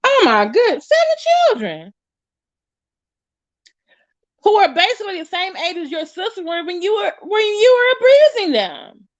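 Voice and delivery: appalled, pitch leaping high; high, aghast outburst; horrified disbelief. Real, not acted. Appalled his children match his sisters' ages, high horrified voice.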